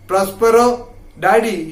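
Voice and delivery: usual speaking voice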